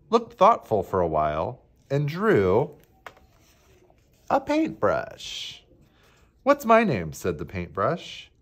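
animated picture-book reading with swooping pitch; expressive storybook reading; story narration. A narrator's reading. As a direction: read it to children, leaning on key words. Reads picture book to children.

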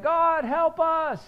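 high, loud desperate plea; panicked cry for help. Retold or imitated. People trapped by the sea crying out, high and loud. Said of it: "They're like".

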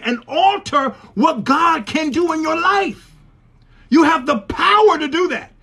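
high, emphatic preacher's cry; full-force preaching. Real, not acted. Preacher tells congregation they have the power, full-force preaching. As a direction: press each phrase hard, pitch lifted.

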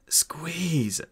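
high, whispery strained gasp; breath-starved plea through pain. Acted. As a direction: gasp it out desperately. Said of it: "gasped the dwarf".

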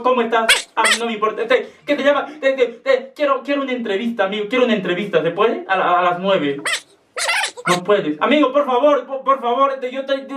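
ordinary talk, nothing marked

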